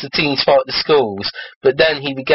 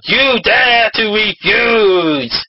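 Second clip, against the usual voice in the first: slow, higher-pitched narrated roar; 6 semitones higher, much slower than usual. A narrator's reading. Raiju answers a refusal, roaring slowly. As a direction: draw out each word in indignation. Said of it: "he roared".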